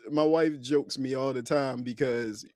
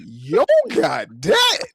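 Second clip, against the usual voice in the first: excited; 9 semitones higher, 6 dB louder, much slower than usual. Retold or imitated. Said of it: "somebody was like".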